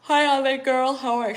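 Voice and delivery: loud, high yawn-stretched greeting; talking through a yawn. Real, not acted. Greeting a viewer while sorry for yawning, words swallowed by a yawn. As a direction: greet them while a real yawn takes over. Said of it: "Sorry, I'm yawning".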